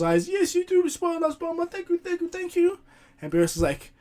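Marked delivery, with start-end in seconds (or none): 0.3-2.8 pitched way up, sweet and flattering; high-pitched woman thanking the host; high Bulma voice. Retold or imitated. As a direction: play the gracious hostess in a high voice. Bulma voiced in retold scene.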